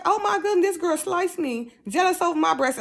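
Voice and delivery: retold shock at the news; amazed.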